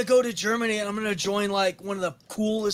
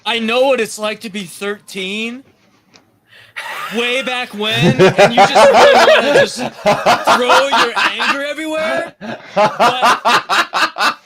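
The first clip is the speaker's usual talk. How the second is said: laughing; 10 dB louder than usual. Real, not acted. Laughing while teasing a friend.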